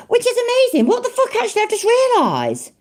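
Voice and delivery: high-pitched, incredulous outburst; astonished realisation. Real, not acted. Commentator spots someone else's video, astonished outburst. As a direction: voice shoots up in sudden disbelief.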